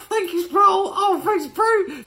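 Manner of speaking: high grateful mock voice; high whiny voice. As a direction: squeak out the thanks. Jordan Lee voiced thanking him.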